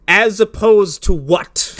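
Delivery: slow sarcastic retort; drawn-out deadpan question. Retold or imitated. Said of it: "I was like".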